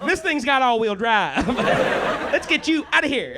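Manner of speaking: hammed-up Alabama drawl; Montgomery, Alabama local. Alabama local offering a truck to move him out, mock Southern drawl. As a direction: stretch it out like a helpful Alabama truck owner. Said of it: "the entire city of Montgomery".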